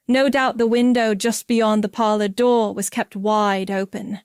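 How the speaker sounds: ordinary delivery, nothing marked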